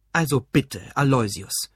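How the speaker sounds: mildly annoyed reproach. A narrator's reading. Father reproving his grown son, annoyed. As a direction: scold with a touch of irritation. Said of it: "sagte sein Vater verärgert".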